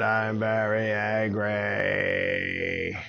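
extremely drawn-out mock scream; angry dragon. Dragon lets anger out as Avon suggested, stretched roar. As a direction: stretch every word into a long dragon roar. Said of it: "Even scream about it if you want".

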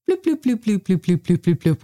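bloops starting very high and dropping down; imitated volume beeps. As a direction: mimic the volume being turned back down. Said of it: "suddenly you'd hear".

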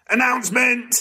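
shouted-up announcer call; high announcement call. Acted. Host calls out announcement.

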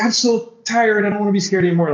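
mock desperate complaint, pitched high; worn-out fear. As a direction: act out being exhausted by fear. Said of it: "at some point you're like".

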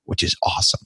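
whispered aside, almost to himself. Real, not acted. A host whispering a pleased aside to the guest. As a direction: let it slip out under your breath.

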